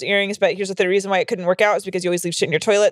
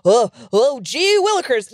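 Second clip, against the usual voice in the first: mock wimpy man; slower than usual. Host mocking easily shocked men, hammed-up wimpy voice. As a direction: play a squeamish goody-goody, pitched up. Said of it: "pussy ass men".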